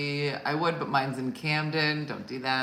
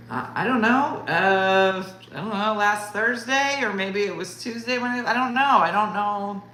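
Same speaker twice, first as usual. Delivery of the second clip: higher, flustered mock panic; flustered stammer; 4 semitones higher, 5 dB louder than usual. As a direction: stammer like you're being cornered. Flustered self under interrogation.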